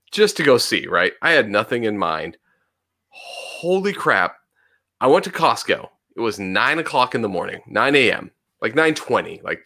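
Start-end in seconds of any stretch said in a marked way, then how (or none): 3.1-4.4 surprised. Real, not acted. Amazed at empty Costco.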